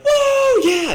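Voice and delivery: high, loud "whoa, yeah!"; wild party whoop. Retold or imitated. Contrasting weed with rowdy drinking, mock party whoop. Imitating rowdy drunk excitement.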